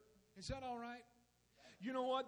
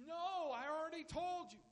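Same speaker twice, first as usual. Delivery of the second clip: exasperated father; 9 semitones higher than usual. Acted. Father losing patience.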